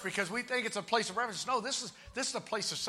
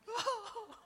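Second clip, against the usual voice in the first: high, soft weepy moviegoer; hammed-up tearful little voice; mock crying; 12 semitones higher, 3 dB quieter, slower than usual. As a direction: imitate someone choked up after a film. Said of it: "they're crying".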